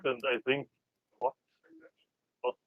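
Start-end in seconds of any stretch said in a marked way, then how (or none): none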